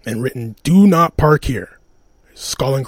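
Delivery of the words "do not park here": each word of "Do not park here" read out slowly and heavily, like a painted warning; slow sign reading. Retold or imitated. Tenant's spray-painted door sign, read out as a slow stern warning.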